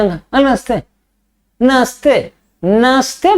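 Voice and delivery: नमस्ते squashed into two syllables, "naste"; shortened greeting. Retold or imitated. Modern boys greeting an elder, नमस्ते clipped to "naste". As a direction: drop the middle "ma" of नमस्ते, mimicking hurried kids. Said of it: "नमस्ते बोले में भी short".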